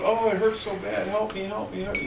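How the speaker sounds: high, whiny cry for help in a joke; whining pained partner. Retold or imitated. Joke teller voicing the hurting partner, high and whiny. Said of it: "and he's going".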